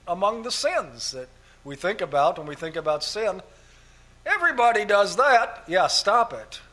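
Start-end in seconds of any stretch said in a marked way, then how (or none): 4.3-6.6 scolding. Real, not acted. Preacher scolds the congregation.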